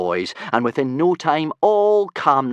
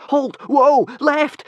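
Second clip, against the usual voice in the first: much higher, louder panicked cries; screeched; 8 dB louder, much slower than usual. Acted. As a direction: yell at a bolting mount. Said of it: "screeched Hiccup".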